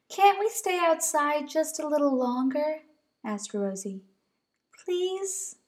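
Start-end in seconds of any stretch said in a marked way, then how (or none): 0.1-2.8 high, pleading little bunny. Acted. Rosie pleading with Mama to stay outside, high bunny voice. 4.8-5.5 high, pleading little bunny. Acted. Rosie pleading with Mama to stay outside, high bunny voice.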